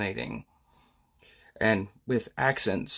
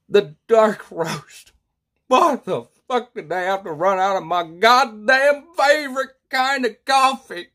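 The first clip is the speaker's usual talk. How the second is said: builds louder and higher into a furious rant; angry coffee rant; 7 semitones higher, 6 dB louder than usual. Acted. Customer fuming that the store ran out of his favorite coffee, angry rant. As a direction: rage at the store for running out, louder and higher each line.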